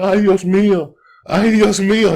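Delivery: loud, high pained cries of Ay, Dios mío; moaning uncle. Retold or imitated. Uncle groaning on the ground after the ladder fell, loud. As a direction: cry out in Spanish after falling.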